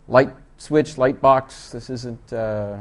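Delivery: ordinary talk, nothing marked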